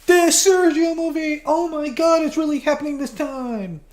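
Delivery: thrilled, high-pitched reveal; excited announcement. Real, not acted. Excited announcement of his movie.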